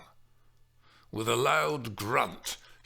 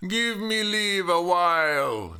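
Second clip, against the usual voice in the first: loud, higher, imperious; demanding nurse; 10 semitones higher, 7 dB louder than usual. Acted. The nurse demanding rest from Juliet, loud. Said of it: "Mimi demanded".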